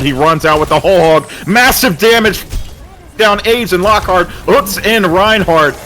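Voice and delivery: high, loud hype call; excited. Real, not acted. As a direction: yell out the big play. Big play, caster hyped.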